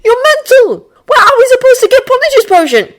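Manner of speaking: high, loud incredulous outburst. Acted. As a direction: blurt it out in disbelief. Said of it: "instead declaring".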